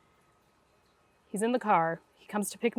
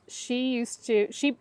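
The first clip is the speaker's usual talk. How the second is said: usual speaking voice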